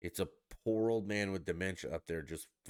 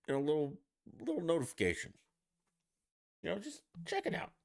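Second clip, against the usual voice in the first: high, quick, breezy mock voice; playfully put-on higher voice; mock casual; 9 semitones higher, much faster than usual. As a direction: toss it off like a shrug. Imitating the IRS being nudged.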